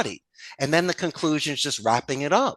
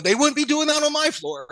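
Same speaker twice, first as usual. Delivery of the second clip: much higher, half-laughing mock yell; retold yelling; 12 semitones higher than usual. Boss scolding call center staff, mock yell. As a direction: bark it like a floor boss. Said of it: "I'm starting yelling at the people".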